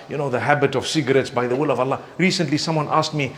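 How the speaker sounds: ordinary talk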